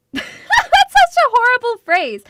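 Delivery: high, loud laughing exclamation; laughing disgust. Real, not acted. Streamer reacts to a creepy test phrase, laughing. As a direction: laugh through it, slow and squealing.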